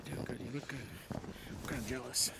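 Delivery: low and very soft; murmured pep talk. Real, not acted. Encouraging both himself and his friend, low murmur. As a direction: murmur it as if to yourself.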